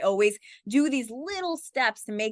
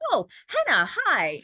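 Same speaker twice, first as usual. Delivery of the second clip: pleased recognition; 5 semitones higher, 5 dB louder than usual. Retold or imitated. Imitates distracted celebrities noticing her.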